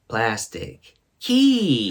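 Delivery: very slow, drawn-out plastic key through a laugh; slow imitation. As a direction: stretch it out like a child guessing. Quoting her wrong answer again.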